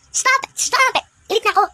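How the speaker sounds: very high, loud stop it; shrieking protest. Acted. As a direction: cry out to make him stop. Rose protesting. (mataas at malakas na pagtutol)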